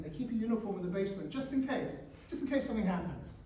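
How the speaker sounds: normal talking voice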